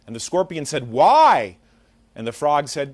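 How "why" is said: one loud, drawn-out WHY; loud, challenging outburst; indignant why. Acted. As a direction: protest it loudly as a question. Scorpion challenges frog's refusal in fable.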